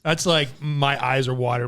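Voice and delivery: normal talking voice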